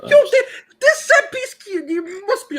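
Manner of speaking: high, loud, half-crying laugh through the words; real helpless laughter. Real, not acted. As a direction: barely get it out for laughing. Cracking up over set piece.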